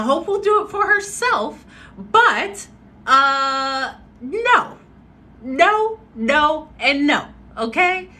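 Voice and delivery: high, loud repeated no; emphatic refusal. Real, not acted. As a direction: refuse over and over, sassy.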